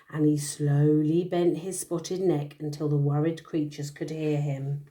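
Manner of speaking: bedtime storytelling voice; story narration. A narrator's reading. Parent reading a picture book to a child, storytelling. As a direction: read the tale aloud to a little one.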